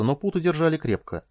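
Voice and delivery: plain reading voice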